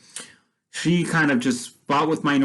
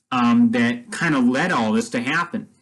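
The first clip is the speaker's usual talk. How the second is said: usual speaking voice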